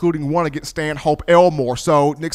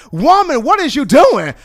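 higher incredulous call; puzzled fans; 7 semitones higher than usual. Retold or imitated. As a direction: ask in mock disbelief. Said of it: "folks are saying".

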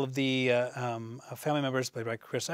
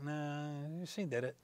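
much softer, knowing retort; dismissive aside; 13 dB quieter, much slower than usual. Retold or imitated. Reviewer rebutting the self-defense claim, much softer retort. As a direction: toss it off under the breath.

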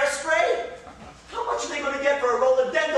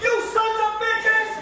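much higher, louder outburst, dragged out; furious shout; 9 semitones higher, 4 dB louder, slower than usual. Acted. Husband bellowing at the burglars who cleaned him out. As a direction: yell it at the whole building.

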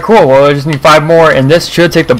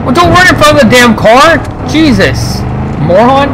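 yelling at driver; 8 semitones higher than usual. Real, not acted. NPC runs in front of car.

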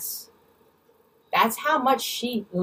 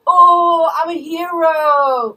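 slow, loud, high mocking boast; high, drawn-out sarcastic imitation; mock self-glorifying; 6 semitones higher, 6 dB louder, much slower than usual. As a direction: draw it out as a hammed-up hero. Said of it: "to make herself like".

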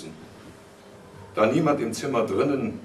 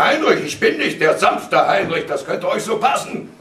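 high, loud mocking protest; irate father rebuffing his wife; heated indignation; 10 semitones higher, 9 dB louder than usual. A narrator's reading. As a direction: refuse to be calmed down. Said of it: "ereiferte sich der Vater".

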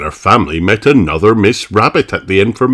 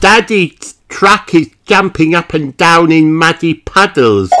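put-on high, excited child character voice; Peppa's cheer; 9 semitones higher than usual. A narrator's reading. Peppa cheers Daddy's jumping truck, excited. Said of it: "Peppa cheered".

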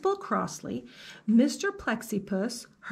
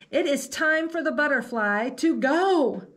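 higher, louder firm order; commanding principal; 5 semitones higher, 5 dB louder than usual. Acted. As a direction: lay down the law. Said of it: "Principal Crossley commanded".

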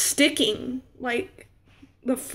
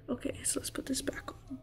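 quick whisper while she works; fast whisper; 18 dB quieter, faster than usual. Real, not acted. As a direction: rush it out in a breathless whisper to herself. Quietly fixing the fallen ear.

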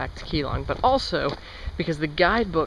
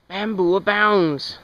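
slow, louder, higher proclamation; grand proclamation; 5 semitones higher, 6 dB louder, slower than usual. Acted. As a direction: announce it like a grand discovery. Grand exclamation at bamboo grove.

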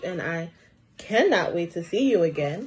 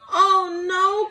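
higher, upset exclamation; dismayed cry; 9 semitones higher than usual. Acted. Emma seeing water spill on her poster, dismayed cry. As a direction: gasp it out as the poster gets soaked. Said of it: "cried Emma".

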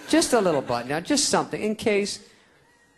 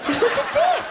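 very high, loud mock screech; hammed-up shrieking insult; scornful grandma; 5 dB louder than usual.

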